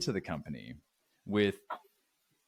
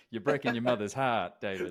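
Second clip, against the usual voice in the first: put-on Italian mama accent over an Australian voice; laughing Italian mother voice; 3 semitones higher than usual. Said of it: "I love that you could do the Italian accent with the Australian accent".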